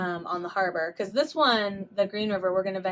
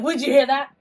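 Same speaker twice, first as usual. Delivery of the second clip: louder, higher pretend panic; mock alarm; 5 semitones higher, 8 dB louder than usual. Ghost hunter startled by a cough, mock alarm. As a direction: overreact to a tiny noise.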